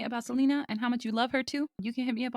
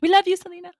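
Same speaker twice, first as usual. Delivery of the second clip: excited shout; 10 semitones higher, 9 dB louder than usual. Real, not acted. Fan tribute call-out.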